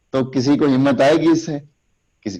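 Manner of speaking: ordinary delivery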